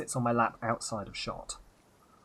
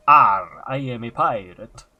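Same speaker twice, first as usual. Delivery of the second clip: booming pirate Arr, loud and hammed-up; 12 dB louder, slower than usual. Shown as a demonstration. Streamer demoing his pirate voice, loud hammy bellow. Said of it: "we're either going to be going".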